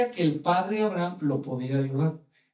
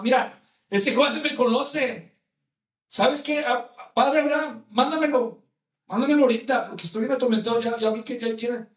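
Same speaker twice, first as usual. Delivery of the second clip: pushy, higher-pitched acted demand; bossy rich man; 5 semitones higher, 4 dB louder than usual. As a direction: press your case like an entitled master. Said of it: "está acostumbrado a ... A mandar". (rico mandón e insistente, actuado)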